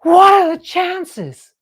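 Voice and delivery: high, loud, slow astonished cry; amazed. Retold or imitated. Man seeing the company tote bag, loud amazed cry. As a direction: marvel at the coincidence, drawn out. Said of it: "And he goes".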